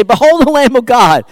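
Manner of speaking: high, loud proclamation of "Behold"; proclaiming loudly. Retold or imitated. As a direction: announce it to the crowd, ringing out. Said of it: "and he would say".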